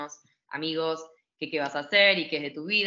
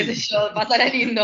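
awkward steward brush-off; 5 semitones higher, 9 dB louder than usual. Retold or imitated. Steward's put-off reply, quoted.

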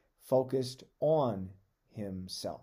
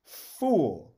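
one loud, stern word, falling; God's rebuke; 4 semitones higher, 9 dB louder than usual. Acted. God rebukes the hoarding rich man, loud stern. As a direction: hurl it down like a verdict. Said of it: "But God said to him".

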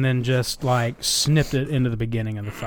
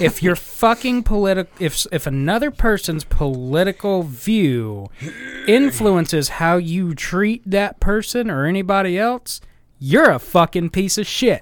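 loud, heated rant, higher and louder; angry rant; 6 semitones higher, 6 dB louder than usual. Real, not acted. Cohost ranting angrily about people judging politics. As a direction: let the anger spill out. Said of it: "I'm just mad".

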